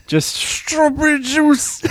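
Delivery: high, slow, drawn-out impression; character impression. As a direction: stretch it out in a silly voice. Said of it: "heard me walking around my house".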